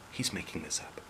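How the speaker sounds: soft whisper in his ear. Acted. Sister whispering to her brother, doubtful whisper. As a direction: lean in and whisper it. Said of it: "she whispered in his ear".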